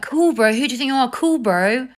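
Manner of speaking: loud, high, indignant parrot-back; acted-out outraged mockery; mocking retort. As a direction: throw it back at them with scorn. Man angrily mocks her reply back.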